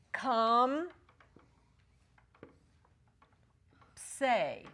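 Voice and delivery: "Come" then "say", split with a long pause; syllable by syllable. Shown as a demonstration. Teacher splitting the word into syllables for students. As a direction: break comp se into two slow syllables. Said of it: "separate it between these two consonants".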